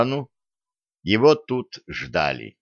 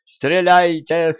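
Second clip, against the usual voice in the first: high, loud panicked squeal; scared merchant screaming; terrified shriek; 9 semitones higher, 4 dB louder, much slower than usual. Acted. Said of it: "заверещал насмерть перепуганный купец".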